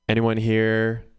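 ordinary talk, nothing marked

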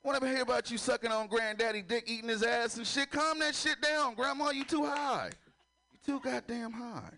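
high, pleading scold of grandma; mock exasperation at high grandma; exasperated scolding. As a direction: tell grandma to calm down. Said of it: "I'd be like".

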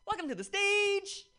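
big booming emcee intro, drawn out; host announcement. Real, not acted. Emcee hyping the next comic to the crowd, booming. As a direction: call the name out to the crowd.

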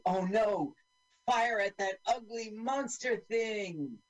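high, drawn-out mock panic; mock alarm. Soldiers ordering fire at the monster, mock alarm.

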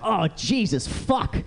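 high, acted yelp at the heat; pained outcry. Man stepping into scorching Vegas sun, pained outcry. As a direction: recoil with a high cry of pain. Sun hurts him as he steps out.

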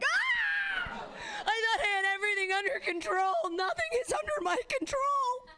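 high, wailing panic over the worm; freaked-out cat owner. Retold or imitated. Owner finding a worm on their face, high panicked wail. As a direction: shriek it like your world just ended. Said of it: "and you're like".

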